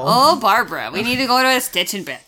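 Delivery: a Midwest Wisconsin lady voice; Wisconsin accent. Acted. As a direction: play a chatty Wisconsin gal. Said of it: "I love that accent".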